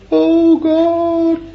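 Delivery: very high, loud mock whine; whining self-pity. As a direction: wail it like a self-pitying weakling. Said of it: "Don't say".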